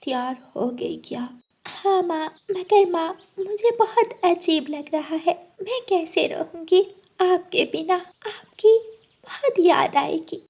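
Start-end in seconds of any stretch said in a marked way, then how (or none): 1.7-10.4 put-on high girlish voice, tearful farewell; young bird daughter. Daughter saying goodbye to mother before wedding, high and girlish. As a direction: voice a shy young bride leaving home.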